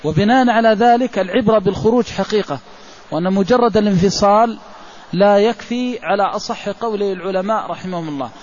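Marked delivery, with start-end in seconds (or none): none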